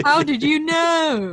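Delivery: high, slow, hammed-up British voice; a laughing put-on British accent; mock British accent. Retelling the mice scene in a mock British accent. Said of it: "they're sort of British".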